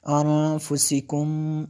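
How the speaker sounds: अनफुसिकुम with a long nasal ghunna on the न, slow. Shown as a demonstration. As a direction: hold the nasal hum on अनफुसिकुम. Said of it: "मैंने गुन्ना किया". (अनफुसिकुम में न पर लंबा गुन्ना)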